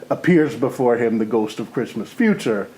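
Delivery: ordinary delivery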